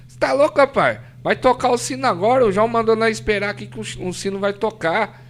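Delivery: animated retold protest; excited exclamation. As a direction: retell his urgent outburst, pitched well up. Friend's excited reply, retold.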